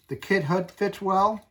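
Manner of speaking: usual speaking voice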